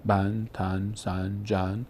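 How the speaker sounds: بن، تن، سن، جن each ending in a clear nasal an; tanween an sounds. Shown as a demonstration. Quran teacher demonstrating tanween sounds to students, spelled out. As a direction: sound out بن، تن، سن، جن slowly, one syllable at a time.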